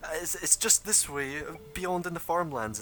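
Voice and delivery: a hint of frantic pleading, not fully played; mildly hysterical. Hysterical women directing the party to the farmlands, mildly frantic. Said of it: "still quite hysterical".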